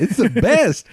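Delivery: high, emphatic delight; gushing enthusiasm. Retold or imitated. Selling Southern cicadas to a doubtful friend, gushing.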